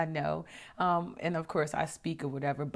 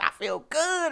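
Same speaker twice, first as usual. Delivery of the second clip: happy reply; 12 semitones higher, 4 dB louder, slower than usual. Retold or imitated. Friend's happy answer.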